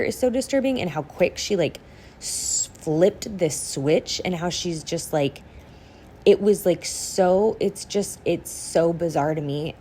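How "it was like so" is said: ordinary talk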